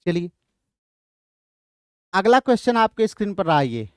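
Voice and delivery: usual speaking voice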